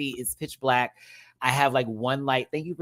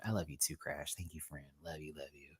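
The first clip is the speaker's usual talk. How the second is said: much softer, lower, murmured thank-yous; tender; 8 semitones lower, 18 dB quieter than usual. Real, not acted. Thanking a supporter named Crash, softly and tenderly. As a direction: hush it down and say it sweetly.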